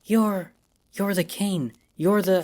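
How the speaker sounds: high, halting, breathless disbelief; Rosie, awestruck at meeting the legend; stunned stammer. A narrator's reading. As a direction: trip over the words in shock.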